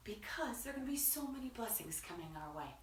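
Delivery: hushed whisper of the blessing. Acted. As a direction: whisper it to the class. Whispered line in a chant.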